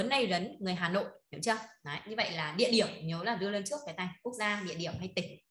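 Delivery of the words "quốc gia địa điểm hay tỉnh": ordinary talk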